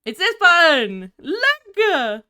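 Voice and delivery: loud, high, delighted exclamation; excited discovery. Real, not acted. Finding the hotkey, she shouts with real excitement to chat.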